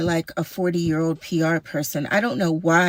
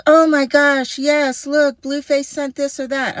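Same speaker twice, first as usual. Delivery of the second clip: mocking gushing; 8 semitones higher, 3 dB louder than usual. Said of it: "you're like".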